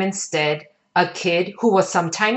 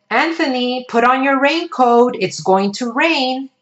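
mother calling; 7 semitones higher than usual. Acted. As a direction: call him like a firm mother. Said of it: "When his mother said".

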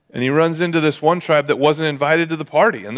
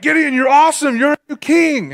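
much higher, fawning crowd voice; adoring crowd; 12 semitones higher, 4 dB louder than usual. Retold or imitated. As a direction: gush over him like a crowd of fans. Crowd hailing Gideon as king.

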